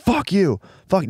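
mock outburst. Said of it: "She'd be like".

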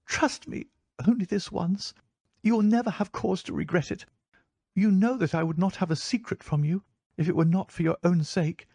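urgent entreaty in a high, light voice; earnest, despairing plea. A narrator's reading. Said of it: "such despair in her manner".

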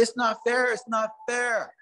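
high-pitched, plaintive protest; boyish complaint. Acted. Boy telling the deer about his family, plaintive complaint.

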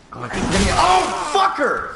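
sudden high yell; startled shout of surprise. Real, not acted. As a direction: yelp and curse as the boss hits. Boss fight surprise.

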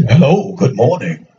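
very low, deep man's greeting; put-on deep phone voice. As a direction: drop way down into a deep male voice. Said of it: "that man with that deep voice".

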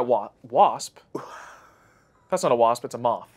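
ordinary delivery

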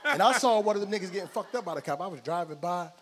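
usual speaking voice

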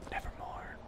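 hushed, whispered poetic quote; soft mock-ominous whisper; raven's whisper.

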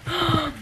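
high, soft gasp of delight. Real, not acted. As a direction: gasp at the puppy. Sees puppy.

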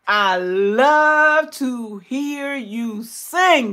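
"I love to hear you sing" stretched slowly, word by word; drawn-out gushing emphasis. Real, not acted. Telling a singer she loves her voice, drawn-out emphasis.

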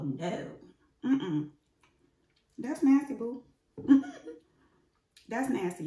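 tasting food with grimace; chewing while talking. Real, not acted. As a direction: mouth partly full of cereal. Seen 1: Spoon in cereal bowl, mouth working, grimacing while tasting throughout.

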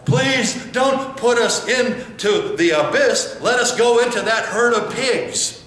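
high, loud, slow pleading cry; pleading demons. Acted. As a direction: beg like frightened demons not to be cast away. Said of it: "They scream and they yell".

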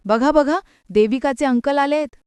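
ordinary delivery, nothing marked

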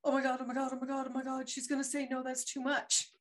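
lightly acted frantic worry; imitating nervous panic. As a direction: replay your racing inner panic. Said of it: "I was like".

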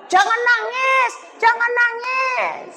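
drawn-out fussy warning, half sung; busybody woman. Retold or imitated. Mocking a woman telling the widow not to cry, high sing-song. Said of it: "Datang ibu yang sok tahu".

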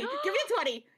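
pushy insistent. Real, not acted. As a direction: keep pestering for it. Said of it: "Do you have to keep going?".

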